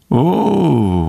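high, drawn-out satisfied oh; contented. A narrator's reading. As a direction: relish it, self-satisfied. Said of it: "mondta Gobó elégedetten".

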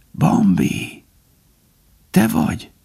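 high, breathy weak question; faint, helpless voice; weak call. A narrator's reading. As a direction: call out feebly from the snow. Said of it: "Gobó hevert ott a hóban, gyámoltalanul".

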